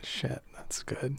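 soft, slow awed whisper. Real, not acted. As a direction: breathe it out, impressed. Impressed aside.

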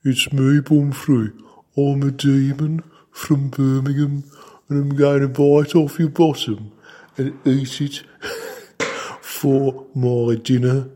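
high, loud camp Brummie demon voice; camp Birmingham accent. Acted. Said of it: "in a camp Birmingham accent".

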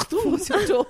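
high, loud imitation of barging through; mock forceful shout. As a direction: heave it out like ramming a door. Acting out man barging through door.

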